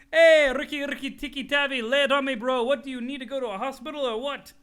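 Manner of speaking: high, loud frantic calling; frantic shout at a sick friend; worried call. Acted. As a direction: yell for your friend to answer.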